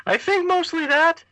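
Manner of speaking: very high squeaky puppet voice; [name] ventriloquist puppet. Pretend. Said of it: "rather ridiculous voice".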